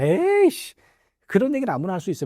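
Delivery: high scornful "에이씨", then a grumble; grumbling scoff. Retold or imitated. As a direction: scoff like an annoyed manager. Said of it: "뭐 이래요". (짜증 섞인 비웃음)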